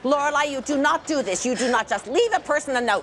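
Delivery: loud, emphatic reprimand; loud motherly outrage; scolding. Acted. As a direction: scold her hard, each word driven home. Mother scolds daughter in labor.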